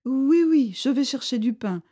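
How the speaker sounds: eager to escape, says yes fast; frightened Bardot. A narrator's reading.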